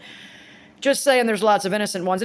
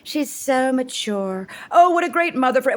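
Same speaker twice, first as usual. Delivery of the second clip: higher, slow gushing mock praise; mocking commenters in a higher, slow gushing voice; 5 semitones higher than usual. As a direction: mimic the gushing online commenters. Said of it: "I know what I'm gonna see".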